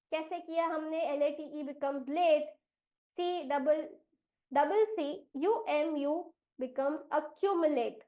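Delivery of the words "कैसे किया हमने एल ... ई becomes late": normal talking voice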